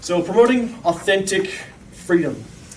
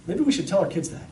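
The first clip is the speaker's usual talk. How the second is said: normal talking voice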